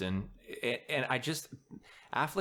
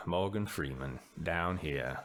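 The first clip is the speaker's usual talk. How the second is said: deep, slow cadence; Morgan Freeman; 5 semitones lower, slower than usual. Retold or imitated. Podcast host showing a celebrity's cadence, deep slow impression. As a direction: drop low and stretch the words out slowly. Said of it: "it's almost a cadence too".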